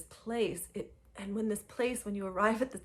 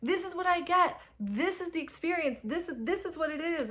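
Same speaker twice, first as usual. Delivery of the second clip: high, elated self-praise; giddy victory; triumphant celebrating; 10 semitones higher than usual. Retold or imitated. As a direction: crow about your win. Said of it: "I was like".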